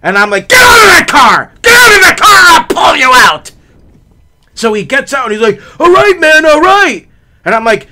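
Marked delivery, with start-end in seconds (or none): 0.5-3.5 loud, high enraged shouting; threatening yell. Retold or imitated. Hit driver ordering the man out of car, threatening yell. 5.8-7.1 high, loud hurried surrender; alarmed placating. Retold or imitated. Older driver calming the man yelling at him, alarmed.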